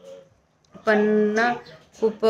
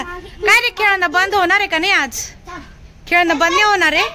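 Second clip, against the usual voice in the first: scolding; 7 semitones higher than usual. Real, not acted. Mother scolding the playing boys.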